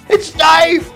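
ordinary delivery

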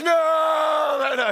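high shouted mock No cut off into okays; hammed-up horror at adopting a cat; loud mock wail of letdown; mock anguished yell.

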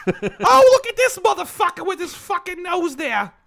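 loud high Brooklyn heckler; Brooklyn accent. Retold or imitated. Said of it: "all the reindeer were from, like, Brooklyn".

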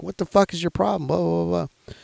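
high-pitched mock outburst, not shouted; retold freak-out. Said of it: "starting to fucking freak out".

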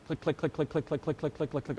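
soft rapid mouth-clicked "click, click, click" like a coaster chain; imitated ratchet clicks. Preacher mimics the coaster climbing to the kids, in soft clicks. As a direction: tick it out quietly, like the lift hill ratcheting up.

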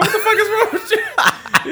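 very high, laughing disbelief; incredulous mock outrage. Man retelling his reply to a forward woman, comically incredulous. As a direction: protest in a comic high pitch.

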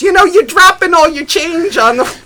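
put-on nosy old woman warning about the dropped change; fussy old woman. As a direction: squeak it like a nosy granny. Old woman voiced in story.

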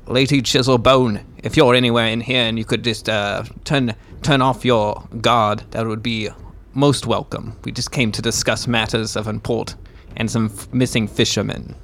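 loud calling out into the dark; shouting. Acted. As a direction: yell it into the cave. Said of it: "and just shout".

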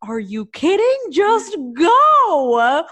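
loud, high exasperated outburst; urgent yell. Retold or imitated. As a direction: yell at the driver to floor it, dragging out the last word. Retold panicked order to the driver.

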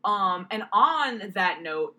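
normal talking voice

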